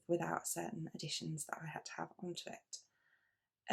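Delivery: soft confidential whisper; whispered aside. Real, not acted. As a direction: lean in and whisper the extra cost. Confiding aside about costs.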